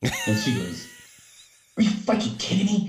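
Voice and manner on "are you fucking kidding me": high-pitched indignant protest; mock outrage. Kid to coach who faulted her handstand, mock outraged. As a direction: voice leaps up in disbelief.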